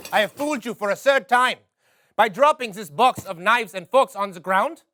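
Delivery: normal talking voice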